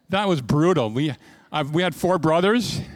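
ordinary delivery

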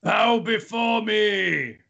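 slow, drawn-out demon command; booming demon lord. Acted. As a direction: demand it like a demon lord on his throne. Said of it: "that's why I gave up doing his voice".

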